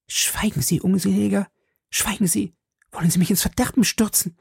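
high, hushed urgent plea; alarmiertes Zischen. A narrator's reading. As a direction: silence him in a high-pitched alarm. Erschrockene Frau bringt Begleiter zum Schweigen. (aufgeregt und hoch, wie erschrocken)

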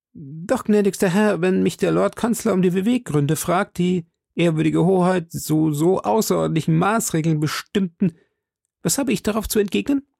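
high, hesitant servant voice; a nervous clerk questioning his master; timid secretary. A narrator's reading. Secretary asks the duke what to tell the chancellor, hesitant.

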